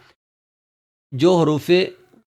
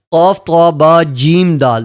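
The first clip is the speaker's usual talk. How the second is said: louder, each letter punched; letters called out loudly; 5 dB louder, slower than usual. Shown as a demonstration. Teacher calling out the qalqalah letters loudly. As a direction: call the letters out loud for the class. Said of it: "حروف قلقلہ میں سے ہے".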